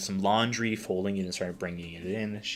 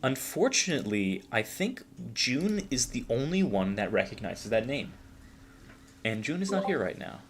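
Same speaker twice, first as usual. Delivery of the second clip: put-on higher young vampire voice, soft-spoken regret; Marionette, polite and regretful; 4 semitones higher, 3 dB quieter than usual. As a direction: break it to them politely, pitched up. Game master voices Marionette.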